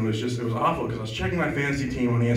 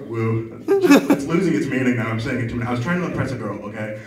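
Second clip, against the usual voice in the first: ordinary talk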